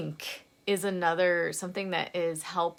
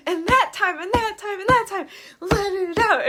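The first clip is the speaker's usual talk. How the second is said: high, loud chant with each punch; punching in rhythm; 12 semitones higher, 8 dB louder than usual. Acted. Punching the pillow for each memory, loud chant. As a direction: hit a pillow on every "that time". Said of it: "punching this pillow".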